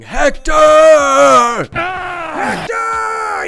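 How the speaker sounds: high, loud calling out of the name; mock battle cry; imitated yell. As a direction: bellow the name like a challenger. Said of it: "he has the iconic moments yelling".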